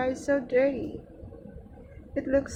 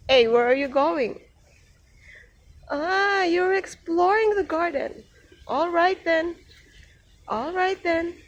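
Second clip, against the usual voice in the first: ordinary talk, nothing marked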